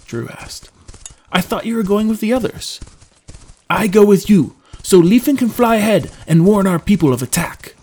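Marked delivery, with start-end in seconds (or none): 1.3-2.8 higher-pitched, mildly puzzled objection; puzzled protest. Acted. Drew questioning his companion's change of plan, puzzled protest. 3.7-7.7 loud, high, forceful character voice; stern companion. Acted. Trendon insisting on joining Drew to warn his people, stern. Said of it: "Trendon replied sternly".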